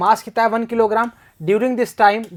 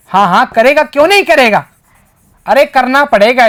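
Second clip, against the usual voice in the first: emphatic; 4 semitones higher, 8 dB louder than usual. Real, not acted. Answers his own question emphatically.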